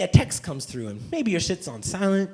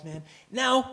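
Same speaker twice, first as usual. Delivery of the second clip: ordinary delivery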